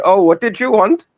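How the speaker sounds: high-pitched cashier asking again; fast food cashier. Retold or imitated. Cashier voice in retold scene.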